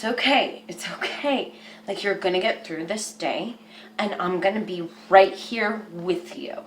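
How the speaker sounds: put-on tender reassurance to the inner child; soft comforting. Shown as a demonstration. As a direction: hush a scared child gently. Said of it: "talk to yourself just like that".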